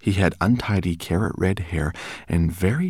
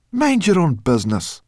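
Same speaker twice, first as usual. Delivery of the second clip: snapping brownie; 12 semitones higher, 8 dB louder than usual. Acted. Brownie snaps at Ben.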